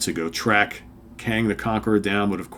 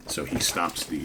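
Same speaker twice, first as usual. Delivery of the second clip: usual speaking voice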